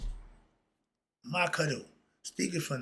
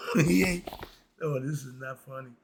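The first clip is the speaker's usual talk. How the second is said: usual speaking voice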